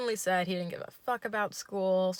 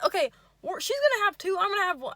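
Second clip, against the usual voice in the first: much higher, louder chirpy okay; high yay voice; 11 semitones higher, 7 dB louder, faster than usual. Retold or imitated. Date agrees in a high goofy chirp. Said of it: "yay voice".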